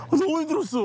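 very high-pitched wail of complaint; exhausted complaint. Real, not acted. Hiker complaining about the hard climb, high wail.

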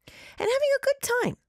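story narration. A narrator's reading. Reads listener story aloud.

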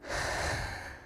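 long, soft exhale to recover; breathy sigh. Real, not acted. Winded host catching her breath on air, soft sigh. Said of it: "I am very out of breath".

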